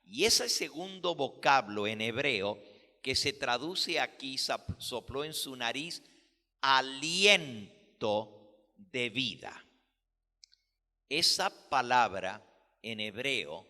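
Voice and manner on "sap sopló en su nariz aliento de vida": ordinary delivery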